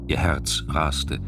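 normal talking voice

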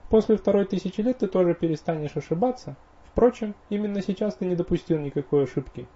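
high, teasing elf woman; playful elf wife. Acted. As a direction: tease him in a high elf voice.

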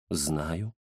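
low, soft, unruffled reply; calm fortune-teller. Acted. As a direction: answer low and quiet, unmoved. Fortune-teller coolly answering angry client. (тихий низкий голос невозмутимой гадалки)

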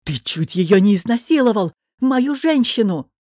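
heated shout of a jealous man; angry accusation. A narrator's reading. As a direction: throw the accusation at a rival mid-fight. Jealous fighter accuses his rival.

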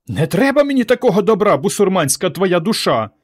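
high, indignant telling-off; scandalized, high-pitched scolding; mother scolding. A narrator's reading. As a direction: berate your son at high pitch. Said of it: "чехвостила мати сина".

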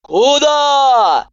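high, loud yell; yelled after. A narrator's reading. Doctor yells after the fleeing patient. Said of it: "завопила мне вслед Иванова".

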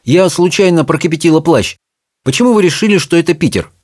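a hint of a high character voice; sheepish Charmain. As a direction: own up, then question the witch.

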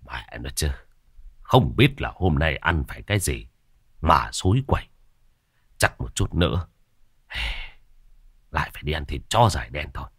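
low, subdued grumble; grumbled cursing. A narrator's reading. Man fed up with his bad luck, grumbled cursing. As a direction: curse under your breath, sullenly. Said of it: "lầu bầu chửi".

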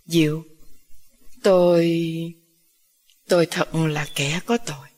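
low, halting confession; choked up. A narrator's reading. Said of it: "Giọng ông Minh vẫn nghẹn ngào".

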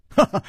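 short, high ha-ha; hearty laugh. A narrator's reading. Chu greeting his old friend, hearty laugh. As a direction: burst out with a brief laugh. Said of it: "楚留香大笑着说".

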